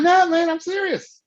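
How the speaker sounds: high, earnest retold protest; insistent protest. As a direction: insist you really mean it. Retells protesting to doubters.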